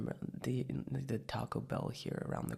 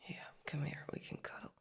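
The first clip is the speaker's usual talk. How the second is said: morning voice; 7 semitones higher than usual. Acted. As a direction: talk like you just woke up in bed. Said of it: "you like my morning voice?".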